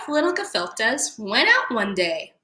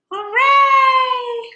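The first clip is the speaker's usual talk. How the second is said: high, loud cheer; cheering hooray; 7 dB louder than usual. Acted. Little fish cheering their swim in the bay. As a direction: shout hooray with joy.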